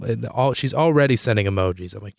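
ordinary talk, nothing marked

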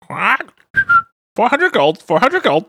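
high, flat secretary voice repeating the price; a hint of a squeaky secretary. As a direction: chirp the price like a wind-up clerk.

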